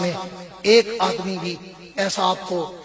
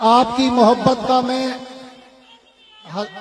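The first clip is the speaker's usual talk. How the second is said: voice breaking with tears; 3 semitones higher than usual. Real, not acted. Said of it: "میری اپنی آنکھوں میں آنسو آ رہے ہیں".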